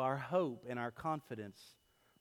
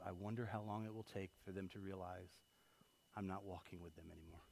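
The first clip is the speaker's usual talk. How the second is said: quiet musing; 8 semitones lower, 14 dB quieter than usual. Retold or imitated. Parent wondering when the kids notice, said quietly. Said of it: "a God who's gonna walk with you".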